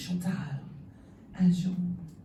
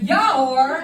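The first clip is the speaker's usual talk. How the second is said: loud Dutch shopkeeper; 3 semitones higher, 10 dB louder than usual. Retold or imitated. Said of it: "just went".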